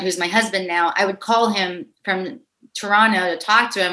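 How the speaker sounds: usual speaking voice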